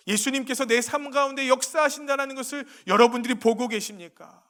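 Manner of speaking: loud, high, impassioned sermon delivery; rising fervent pulpit voice; full-force preaching. Acted. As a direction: preach it out to the whole hall.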